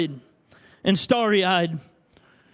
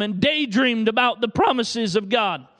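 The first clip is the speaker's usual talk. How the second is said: usual speaking voice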